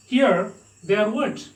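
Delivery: ordinary delivery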